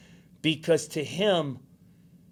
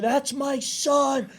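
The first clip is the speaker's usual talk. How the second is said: high, drawn-out mock cheer; proud dad yelling; 10 semitones higher, much slower than usual. Dad cheering his son at a standing ovation, mock yell. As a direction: stretch it out like a distant proud yell. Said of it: "He's yelling".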